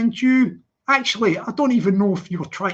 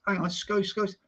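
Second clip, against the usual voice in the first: usual speaking voice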